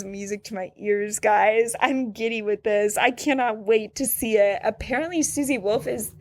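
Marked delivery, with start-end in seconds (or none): none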